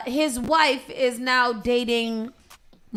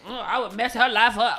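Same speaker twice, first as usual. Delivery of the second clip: laughing mock quote.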